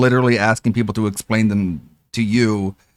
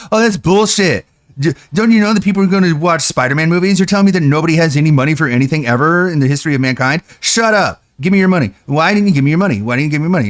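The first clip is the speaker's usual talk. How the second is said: higher, louder scoffing imitation; mock streamer rant; 4 semitones higher, 3 dB louder, faster than usual. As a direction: scoff and demand money like a greedy streamer.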